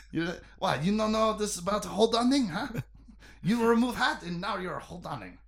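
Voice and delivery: a hint of a joke French accent; quiet, teasing mock French accent. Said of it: "I don't know why he's French".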